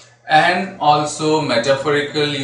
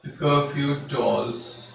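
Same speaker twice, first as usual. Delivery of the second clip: poem recitation; 2 semitones lower, 10 dB quieter than usual. Shown as a demonstration. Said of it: "And how the line goes".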